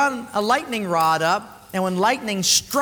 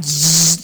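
zap sound; 2 semitones lower, 8 dB louder than usual. Pretend.

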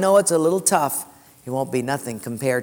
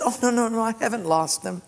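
a touch higher, cheerful widow; light widow voice; 7 semitones higher than usual. Retold or imitated. Lightly voiced widow reassuring the pastor, brushing it off. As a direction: play the widow brushing off his pity. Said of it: "She said".

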